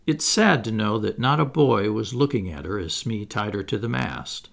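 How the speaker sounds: ordinary delivery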